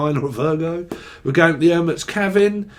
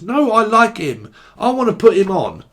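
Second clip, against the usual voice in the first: louder, higher petulant demand; mock insistent partner; 5 semitones higher, 4 dB louder than usual. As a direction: whine that you want him on.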